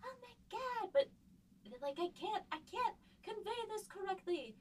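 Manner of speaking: high-pitched mock-frustrated wail. Writer recalling her struggle with the fight scene, high-pitched frustrated outburst. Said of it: "I was getting so frustrated".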